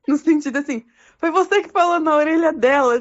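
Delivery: high, loud, amused mock accusation; laughing while quoting. Real, not acted. God playfully calling Yahushua to sort it out, laughing. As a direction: push the quote out loudly through laughter. Teller laughing through God's acted line.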